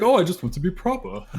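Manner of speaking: high, prissy mock-British voice; lightly imitating a proper British accent. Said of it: "Don't talk like, just, just don't talk like that anymore".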